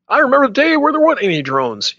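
high, quavering mock old man; old-timer voice. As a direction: play a nostalgic grandpa. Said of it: "when we're super old".

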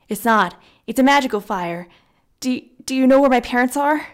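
fast, loud and desperate; rushed, panicking question; frantic. A narrator's reading. Frantic boy asks about parents.

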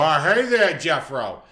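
put-on loud drunken greeting; drunk dad yell. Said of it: "He'd go".

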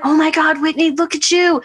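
high, thrilled cheer; excited family. Retold or imitated. Family in the living room cheering Whitney's back bend, high and thrilled. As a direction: gush like proud relatives.

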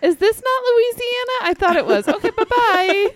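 high, loud sing-song confusion; mock lost tourist. Co-host acting a confused visitor at the wrong garage, high and loud. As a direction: play a chirpy lost visitor making a quick exit.

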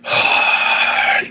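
long, voiceless breathy sigh; acted sigh. Retelling Desire's arrival after the club blows up, acted sigh. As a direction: let out a long exhale in character.

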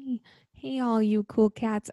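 Carole Baskin attempt. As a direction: ease into it gently. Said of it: "that one was really good".

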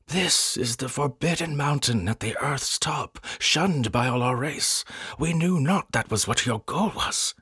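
high-pitched, agitated warning; excited. A narrator's reading. Noscat telling explorers of the forbidden mountain, excited. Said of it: "Noscat told us excitedly".

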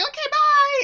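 very high, squealed mock sign-off; horrid awkward cackle. Woman hanging up on a voicemail, with an awkward cackle. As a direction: imitate an awkward cackling goodbye. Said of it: "she does this horrid, awkward, like, cackle".

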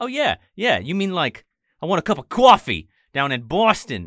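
put-on a parody of a thick Boston accent; overdone high Boston accent, mocking the actors. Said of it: "everyone was just like".

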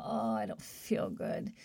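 slow, weak whispered moan of someone coming down ill; sick groan. Retold or imitated. Telling listeners how early sickness feels, slow feeble whisper. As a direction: let it sag out in a breathy whisper like you feel a cold coming on. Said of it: "you know how you start to feel".

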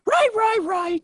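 high, loud hammed-up British voice; a silly put-on posh Brit; mock British. Friends riffing on a British court, hammed-up British accent.

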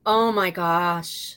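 slow, heavy shock at awful news; stunned dismay. Real, not acted. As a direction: draw the words out in dismay. Reacts to news of suicide.

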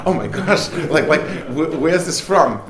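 loud, high startled exclamation; shocked surprise. Retold or imitated. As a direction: gasp it out, caught off guard. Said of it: "my mother-in-law was like".

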